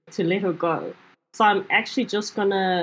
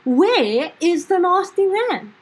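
high, louder, slow demand; 8 semitones higher, 4 dB louder than usual. Acted. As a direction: insist on an answer. Goblin demands angrily.

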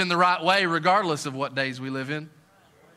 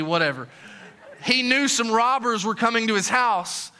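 ordinary talk

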